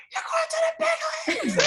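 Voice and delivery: very high mock-mom shriek; screaming excited mom. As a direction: squeal it like a thrilled mom. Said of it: "that's way better".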